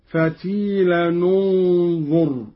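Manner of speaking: فتيلا ننظر joined as fatilan-u-nzur, slowly; tanwin with damma. Shown as a demonstration. Teacher demonstrates joining the words for students, slow. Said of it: "بضم التنوين حالة الوصل هكذا".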